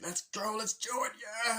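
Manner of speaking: cartoon minions hyped up, pitched high; rally the troops in a squeaky voice; high excited Koopa cheer. Koopa army hyping each other up, high gleeful cheer.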